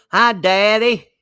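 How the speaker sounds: put-on high, loud daughter in a thick holler accent; [name], Kentucky holler accent. Daughter greeting her dad on the phone, thick Kentucky accent. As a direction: imitate a girl's heavy Kentucky hill accent. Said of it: "that accent just took her over".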